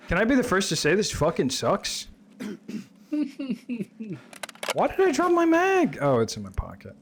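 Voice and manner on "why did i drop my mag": high-pitched, baffled outburst; alarmed question. Real, not acted. Player thinks the mag has been dropped, high alarmed question. Panics over dropped magazine.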